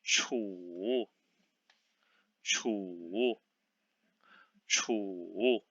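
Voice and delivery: chu in a dipping third tone, said softly; teacher drilling chu, third tone; modelled Mandarin syllable. Shown as a demonstration. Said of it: "which one is the pinyin of".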